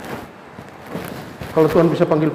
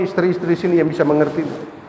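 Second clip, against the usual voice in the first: ordinary talk, nothing marked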